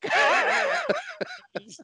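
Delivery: drawn out slowly, high and laughing. Real, not acted. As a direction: squeal it through laughter. Laughing at the story.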